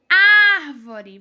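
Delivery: Árvore with a loud, long first syllable Ár; Árvore called out, first syllable strongest; stress on AR. Shown as a demonstration. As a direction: punch and hold the Ár of Árvore. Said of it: "qual é a sílaba que é falada com mais força".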